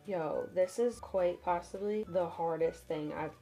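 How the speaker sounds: ordinary talk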